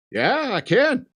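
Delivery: high, swooping playful answer; string him along in a high voice; teasing. Retold or imitated. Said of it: "he's just messing with him".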